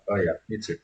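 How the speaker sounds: ordinary talk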